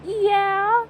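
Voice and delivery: high, rising ad-lib yeah. Retold or imitated. Vlogger mimicking the song's background ad lib, high yeah. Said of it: "that's what the ad lib is".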